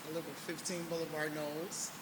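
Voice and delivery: speaking too softly, quickly; mumbling off the mic; too quiet. Real, not acted. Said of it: "Can you speak up".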